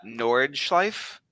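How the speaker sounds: Nordschleife sounded out very slowly; careful butchered German. Shown as a demonstration. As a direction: Nordschleife read syllable by syllable, unsure. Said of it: "I'm gonna butcher how to pronounce this".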